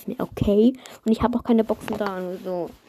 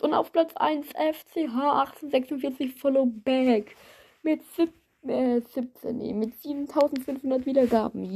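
hyped giveaway announcement; 4 semitones higher than usual. Real, not acted. Announcing first place winner.

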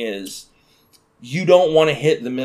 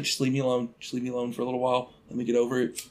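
quiet plea; 2 semitones lower, 9 dB quieter, faster than usual. Retold or imitated. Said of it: "and I was like".

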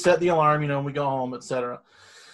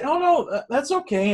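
awkward polite; 11 semitones higher than usual. Retold or imitated. Polite reply to old lady.

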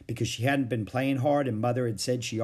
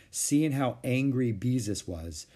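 ordinary delivery, nothing marked

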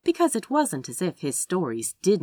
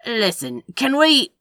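raised, louder, pressing voice; urgent interjection; 8 semitones higher, 8 dB louder than usual. A narrator's reading. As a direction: try to cut in. Crowley tries to interrupt.

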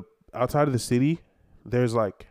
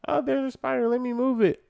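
mocking calm voice; 13 semitones higher than usual. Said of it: "they just be".

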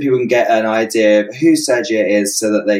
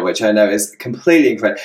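usual speaking voice

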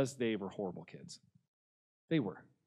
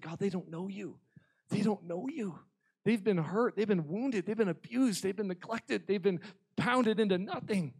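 high, impassioned plea, building; crying out; 6 semitones higher than usual. Retold or imitated. Youth pastor interceding for hurt kids, impassioned crying out. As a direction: plead their case with rising urgency. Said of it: "I start crying out".

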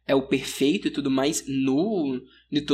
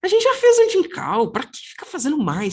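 indignant protest; 7 semitones higher, 6 dB louder than usual. Real, not acted. [name] protests another Incal episode.

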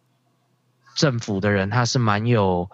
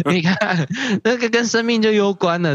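laughing; 9 semitones higher than usual. Real, not acted. Laughs at own joke.

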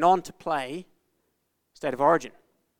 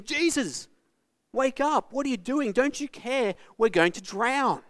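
high, urgent cry to a sleeping friend; higher, desperate friends shouting over the storm; panicked plea; 7 semitones higher than usual. Retold or imitated. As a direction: beg him to wake up, frightened.

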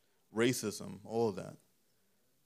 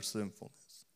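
softer, voice failing on the word; voice giving out; 8 dB quieter, slower than usual. Real, not acted. Preacher ending a forceful sentence, voice giving out. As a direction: lose your voice on SINFULNESS. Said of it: "I lost my voice right there".

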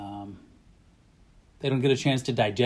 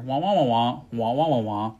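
muffled wah wah talk. Retold or imitated. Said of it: "you're the teacher".

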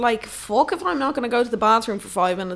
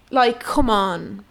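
usual speaking voice

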